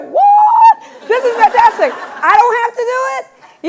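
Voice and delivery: high, loud squeal of delight; thrilled outburst. Retold or imitated. Director reacting to writer's pages, thrilled outburst. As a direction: burst out high and loud in amazement.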